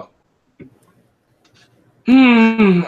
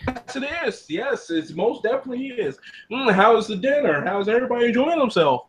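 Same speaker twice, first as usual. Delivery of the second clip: higher-pitched cook deflecting nervously to his dinner guests; nervous host voice; 7 semitones higher than usual. Acted. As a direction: cheerfully dodge the question.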